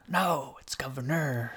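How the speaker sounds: mock correction into an American accent; exaggerated American accent, joking correction; American accent, correcting the British one. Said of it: "how they lost their accent".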